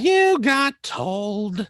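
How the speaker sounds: slow, drawn-out singsong taunt; mocking taunt. Real, not acted. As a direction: rub it in slowly, teasing. Teasing co-host after retort.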